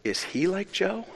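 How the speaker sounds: hushed question, rising at the end; soft questioning. Retold or imitated. Drunk man asking the preacher about Jesus, soft questioning. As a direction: imitate a quiet, earnest query with lifted pitch.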